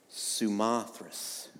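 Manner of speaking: Samothrace said very slowly and softly; slow, careful Samothrace; savoring the word. Shown as a demonstration. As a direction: stretch out Samothrace, relishing its sounds. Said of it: "that's a fun word to say".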